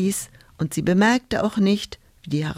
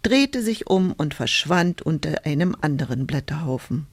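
plain reading voice

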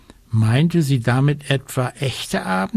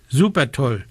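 ordinary delivery

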